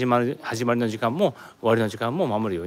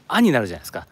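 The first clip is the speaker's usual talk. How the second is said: normal talking voice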